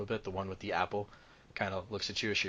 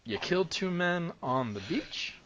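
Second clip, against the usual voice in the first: slow, raised-pitch incredulous question; questioning constable; 10 semitones higher, slower than usual. Acted.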